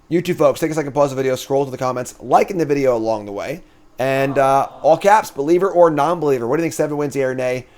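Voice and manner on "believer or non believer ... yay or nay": normal talking voice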